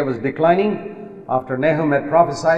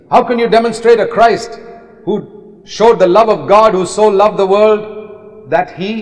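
higher and louder, building; preaching at full force; 4 semitones higher, 5 dB louder than usual. Real, not acted. Preacher challenging leaders, higher and louder. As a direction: challenge them full-voiced.

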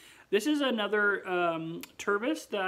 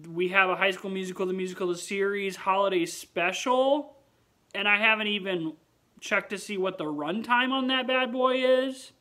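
excited; 3 semitones higher, 7 dB louder than usual. Real, not acted. Excited about holiday special.

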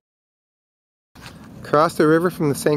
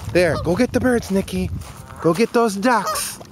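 urging dog; 7 semitones higher than usual. Real, not acted. Sending dog after ducks.

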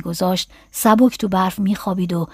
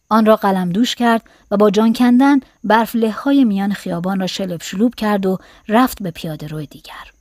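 usual speaking voice

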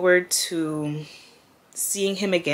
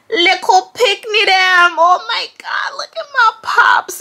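high, slow cry breaking over the photo; 6 dB louder, slower than usual. Real, not acted. To viewers, seeing her late father's photo, crying out high. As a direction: voice cracking into a high wail.

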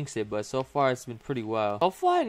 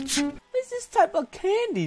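high, slow strained squeak; high squeaky disbelief. Real, not acted. Reacting to sour candy.

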